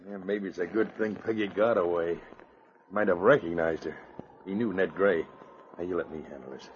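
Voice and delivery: low, hushed conspiring; wary, softened voice; furtive undertone. Acted. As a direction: confer so the approaching lawman can't hear. Outlaws conferring as Mountie approaches.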